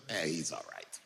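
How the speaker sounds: low, quiet offhand shrug; grudging, underwhelmed verdict said under the voice; unimpressed. Retold or imitated. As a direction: drop it low and soft, not impressed. Acted unimpressed reply to waitresses' gushing.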